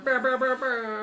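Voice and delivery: loud, high buzzing horn blasts; air horn imitation. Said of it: "Air horn".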